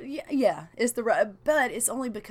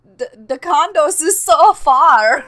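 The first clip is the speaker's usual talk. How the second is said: loud, high mock-German whine; hammed-up German, drawn out slowly; really bad German accent; 8 semitones higher, 15 dB louder, slower than usual. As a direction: shout it in terrible fake German. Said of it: "that's the really bad German one".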